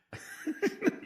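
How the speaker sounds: breathy high burst of laughter; unvoiced laugh. Real, not acted. Laughs at own anecdote.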